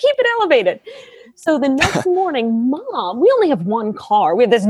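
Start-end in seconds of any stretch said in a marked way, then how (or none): 0.0-0.8 laughing mock nurse advice. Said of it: "Steph's like".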